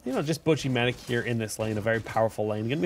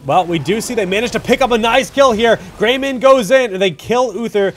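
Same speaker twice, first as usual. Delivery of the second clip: rising, louder hype as the kill lands; excited play-by-play; 10 semitones higher, 11 dB louder than usual. Real, not acted. Caster calling the fight to viewers, excited and loud. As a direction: call the fight like a caster hitting the big moment.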